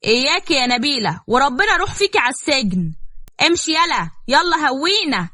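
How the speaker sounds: high-pitched comic threat, rising; heated bickering. A narrator's reading. Acted mother-son threat and dismissal. (خناقة بصوت عالي الطبقة)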